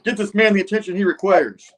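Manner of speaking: high, loud acted command; urgent order. Norman calling for help for the injured man, urgent high order. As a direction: call it out urgently at high pitch.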